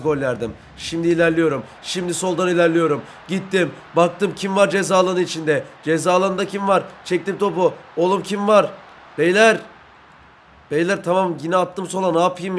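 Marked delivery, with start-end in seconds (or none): none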